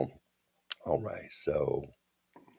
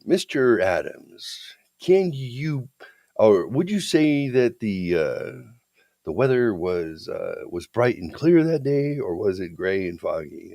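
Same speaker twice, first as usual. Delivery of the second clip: slow, drawn-out courtroom questioning; mealy-mouthed defense attorney; 2 semitones higher, slower than usual. Retold or imitated. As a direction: imitate a hesitant, mealy-mouthed lawyer. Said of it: "that mealy mouth".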